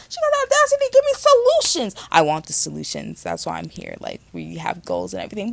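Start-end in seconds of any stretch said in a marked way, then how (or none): 0.1-2.1 mock outrage. Real, not acted.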